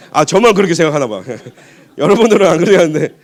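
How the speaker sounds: amused, laughing through the words. Real, not acted. Preacher teasing congregation that only he feels so, laughing. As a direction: joke with the audience while laughing.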